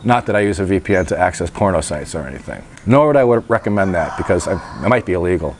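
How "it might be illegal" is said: usual speaking voice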